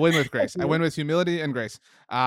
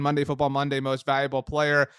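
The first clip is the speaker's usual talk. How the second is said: ordinary talk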